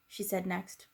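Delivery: usual speaking voice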